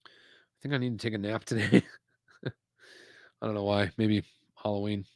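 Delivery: talking through a yawn; sleepy, yawning words. Real, not acted. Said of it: "Sorry about the yawns".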